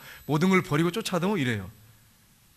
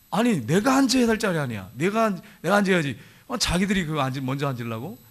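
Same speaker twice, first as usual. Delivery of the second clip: indignant. Acted. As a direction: act out an affronted rival grumbling. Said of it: "뭐 이러면서 이 화가 나는 거죠". (발끈해서 따지는 흉내)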